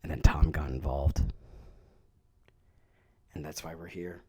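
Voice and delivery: low, close-mic hushed voice; asmr whisper. Acted. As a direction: lean into the mic, low and intimate. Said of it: "get very close to my mic here".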